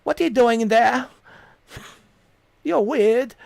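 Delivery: high-pitched, imitated Scottish woman's accent. Avatar's owner confronts the intruder in her pod, mock Scottish accent. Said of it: "Or is a Scottish accent".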